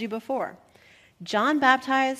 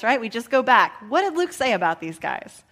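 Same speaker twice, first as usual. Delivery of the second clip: ordinary delivery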